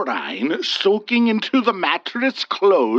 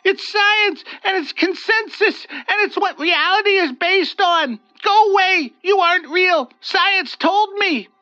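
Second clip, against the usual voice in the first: high, tearful pleading; in tears; 3 dB louder than usual. Acted. Olga in tears before the wraith, tearful plea. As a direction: cry it out, begging it to leave. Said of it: "she answered in tears".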